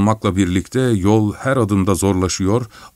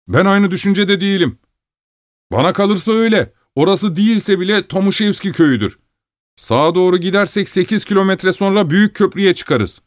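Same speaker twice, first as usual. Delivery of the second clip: higher, insistent driver's voice; stubborn driver; 10 semitones higher than usual. Acted. Driver Ignat disputing the miller's route, insistent. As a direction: hold your ground against him.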